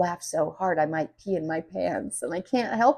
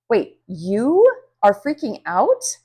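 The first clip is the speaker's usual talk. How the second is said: slow, louder surprised question; disbelieving surprise; 3 semitones higher, 8 dB louder, slower than usual. Acted. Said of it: "I'm really surprised".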